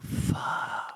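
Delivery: breathy whispered fuck under the breath; whispered curse. Retold or imitated. Dismay on plane, inner curse.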